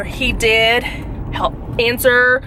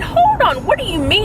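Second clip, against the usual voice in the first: indignant disbelief; 9 semitones higher than usual. Retold or imitated. Caller pushing back at rude airline agent, shocked high voice. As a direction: voice shoots up high in outrage. Retold angry phone call.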